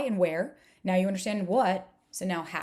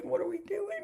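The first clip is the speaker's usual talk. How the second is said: pitched higher in mock disbelief; mock exasperation; 13 semitones higher, 3 dB quieter than usual. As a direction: throw up your hands, voice climbing. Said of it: "And I'm like".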